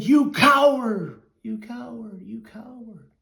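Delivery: mock fury bouncing off canyon walls; angry yell fading to echo. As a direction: hurl the insult, then let it echo away. Boy yells insult, echo returns.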